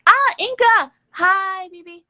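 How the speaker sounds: high, loud squeal of hello; excited greeting. Real, not acted. Podcaster greeting Inca mid-story, excited and high. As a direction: break off and gush a high-pitched hello.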